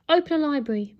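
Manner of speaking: high, loud robot butler announcement; posh robot voice. A narrator's reading. Robot butler lists royal duties, posh robot voice. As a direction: proclaim it like a posh machine. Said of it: "in its posh robot voice".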